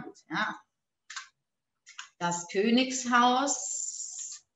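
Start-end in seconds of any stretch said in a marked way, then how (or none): 2.2-4.4 drawn-out words for writing down; slow teacher dictation. Shown as a demonstration. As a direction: dictate slowly, stretching each word.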